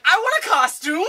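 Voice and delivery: put-on high, childlike excitement; hammed-up gleeful request; mock eager. Acts out eager hero reply.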